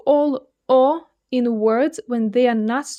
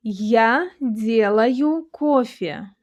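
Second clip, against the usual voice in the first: slow, drawn-out phrase for learners to repeat; measured language-teacher demonstration; slow model reading; slower than usual. Shown as a demonstration. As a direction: read it slowly, syllable by syllable. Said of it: "shadow what I'm saying".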